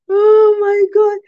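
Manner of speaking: high, squealing laugh through the words; helpless laughter. Real, not acted. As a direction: laugh so hard the words stretch out. Cracking up at her own joke.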